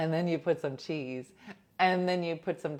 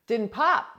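indignant Diana; 7 semitones higher, 10 dB louder than usual. Retold or imitated. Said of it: "She's like".

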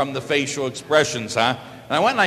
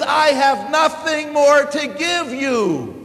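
very slow, higher and louder; slow emphatic; 8 semitones higher, 4 dB louder, much slower than usual. Acted. God telling his people he has nothing more to give, very slow. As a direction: stretch every word out, pleading. Repeated slower, fuller.

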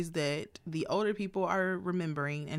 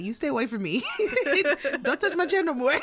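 high, louder laughing mock scolding; grumpy uncle; 9 semitones higher, 4 dB louder, faster than usual. Uncle warning the brother off his chair, laughing mock scold. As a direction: scold him while cracking up. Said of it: "he said".

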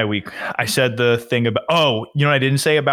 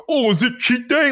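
much higher, sing-song teasing jab; mocking friends; 11 semitones higher than usual. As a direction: mimic everyone's tired old joke. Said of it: "everyone's like".

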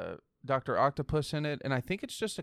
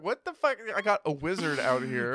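much higher and louder, exasperated; mock outrage; 4 semitones higher, 4 dB louder than usual. Friend playing Peter, outraged. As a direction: blurt it out in disbelief. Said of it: "I'd be like".

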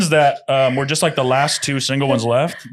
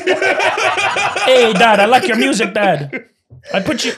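higher-pitched, hammed-up Jamaican accent; comically bad Jamaican accent; 7 semitones higher, much slower than usual. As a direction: play a musician's son with a Jamaican lilt. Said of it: "I was gonna be [name]".

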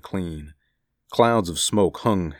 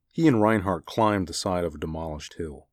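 ordinary delivery, nothing marked

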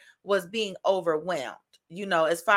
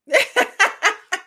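high loud laugh; 11 semitones higher, 8 dB louder than usual. Real, not acted. Laughing at her own love of naps, high loud laugh.

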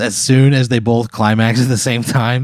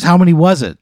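higher, louder insistence; angry demand; 7 semitones higher, 6 dB louder than usual. Acted. Wife demanding a head count from comedian, angry demand. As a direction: press hard for the answer, voice raised.

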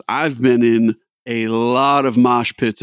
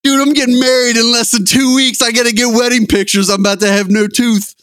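high, loud panicked outburst; panicked reaction; 8 semitones higher, 4 dB louder than usual. Retold or imitated. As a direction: blurt it out in alarm, high and urgent. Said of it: "my first reaction is".

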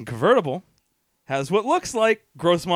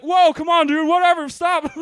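higher, louder mock pleading of party kids; pleading passengers; 7 semitones higher, 4 dB louder than usual. Passengers begging the Mustang driver, high mock pleading. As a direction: beg your buddy to calm down. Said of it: "everyone else in the car going like".

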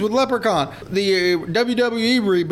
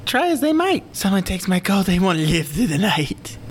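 rough Irish leprechaun rhyme. Acted. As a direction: recite it like a menacing leprechaun. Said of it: "the perfect Leprechaun voice".

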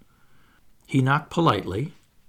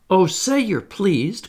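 pleading cry; 5 semitones higher, 3 dB louder, slower than usual. Acted. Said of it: "cried Nibs".

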